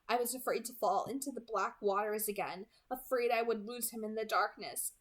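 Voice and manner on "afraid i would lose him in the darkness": plain reading voice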